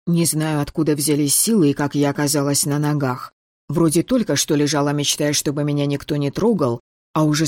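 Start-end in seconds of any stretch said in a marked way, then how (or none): none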